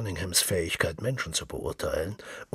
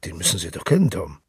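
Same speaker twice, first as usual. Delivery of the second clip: firm and hearty, a touch louder; manly; 8 dB louder, faster than usual. A narrator's reading. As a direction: assert it like a man of the world. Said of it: "sagte Mister Cunningham mannhaft".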